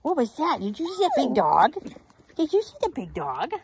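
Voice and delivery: high, loud excited voice; excited pet talk. Real, not acted. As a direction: gush at the dogs. Excited at the big dog.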